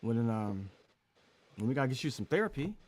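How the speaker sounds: retold stunned dad going quiet, with halting pauses; real quiet. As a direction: let it out quietly after a long pause. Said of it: "real quiet".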